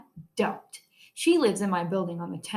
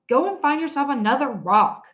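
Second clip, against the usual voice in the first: shouted; 5 semitones higher, 5 dB louder than usual. A narrator's reading. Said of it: "I shouted".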